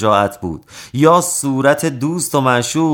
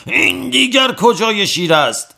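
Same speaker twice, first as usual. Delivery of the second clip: a much higher, pained outcry in a put-on voice; wailing complaint; 12 semitones higher than usual. A narrator's reading. Strongman wailing at the tattooist, high pained cry. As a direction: wail the question through the pain. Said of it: "باز پهلوان فریاد و فغان سر داد".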